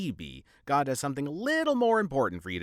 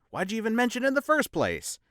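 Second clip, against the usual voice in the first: usual speaking voice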